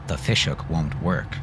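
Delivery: ordinary delivery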